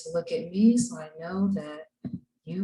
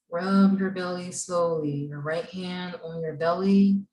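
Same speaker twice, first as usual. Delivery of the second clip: guided breathing. Acted. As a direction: lead the class gently through each breath. Leads class breathing exercise.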